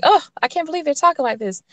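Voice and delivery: high, quick pearl-clutching disbelief; imitating a shocked pearl-clutcher; mock aghast. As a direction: play scandalized, pitch jumping up on "Oh". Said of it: "I'm clutching pearls".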